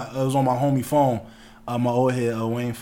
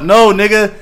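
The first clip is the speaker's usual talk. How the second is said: outraged protest; 8 semitones higher, 10 dB louder, slower than usual. Real, not acted. Reacting to friend's crude joke.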